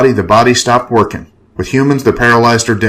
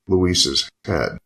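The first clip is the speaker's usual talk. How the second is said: ordinary delivery, nothing marked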